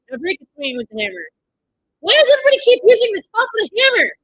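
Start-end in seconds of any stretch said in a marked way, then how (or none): 2.0-4.1 exasperated shout. Real, not acted. Viewer yelling at fight scene.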